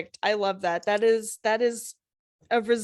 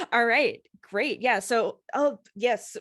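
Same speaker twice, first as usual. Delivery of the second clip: ordinary talk, nothing marked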